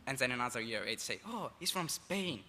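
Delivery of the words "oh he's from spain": high, drawn-out knowing "oh"; mock realization. Exchange student mimics a year eight guessing his country, mock realization. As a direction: imitate a kid announcing a discovery, slowly.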